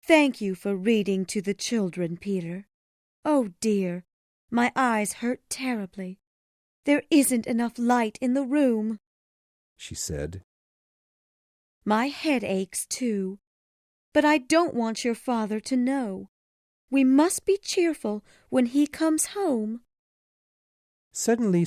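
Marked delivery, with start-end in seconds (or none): 0.0-9.0 high-pitched mother's voice, complaining of her aches; high, tired mother; weary mother character. A narrator's reading. 11.9-19.9 high-pitched mother's voice, complaining of her aches; high, tired mother; weary mother character. A narrator's reading.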